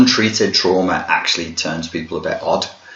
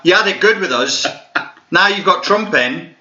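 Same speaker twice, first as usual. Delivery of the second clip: laughing tease; 9 semitones higher than usual. Real, not acted. Laughing mock rant at Americans.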